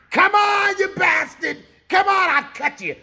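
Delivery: 高く大声で「Come on」と怒鳴る; ナイフを振りかざし叫ぶように挑発; furious shout. Retold or imitated. Cookie dares them with a knife.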